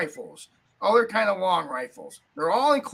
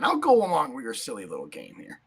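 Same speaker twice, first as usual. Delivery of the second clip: mock annoyance; 7 semitones lower than usual. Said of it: "I'm just joking".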